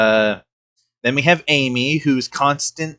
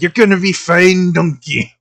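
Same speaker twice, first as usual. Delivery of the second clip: mock Shrek hype; 5 semitones higher, 6 dB louder than usual. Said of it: "he would always go and be like".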